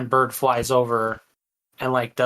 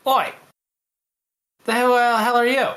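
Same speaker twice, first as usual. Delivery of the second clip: put-on high, loud, wobbly Welsh accent; put-on Welsh lilt, pitched up; attempted Welsh accent; 11 semitones higher, 5 dB louder than usual. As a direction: call out in a rough attempt at Welsh. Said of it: "did you become from Wales".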